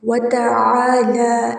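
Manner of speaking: تعالی with the long ا after ل held out; slow recitation with a lengthened ا in تعالی; stretched vowel. Shown as a demonstration. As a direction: draw out the ا in تعالی. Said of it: "بغیر کھینچے نہیں پڑھیں گے".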